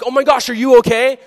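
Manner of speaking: high, loud mock alarm; hammed-up worry for the bitten friend. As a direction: gasp out concern in a big high voice. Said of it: "They're not like".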